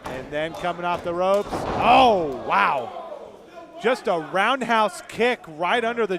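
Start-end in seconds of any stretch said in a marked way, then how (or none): none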